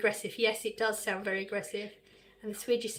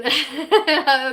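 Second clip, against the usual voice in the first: loud laugh; 4 semitones higher, 10 dB louder than usual. Real, not acted. Reacts to farmer accent comment.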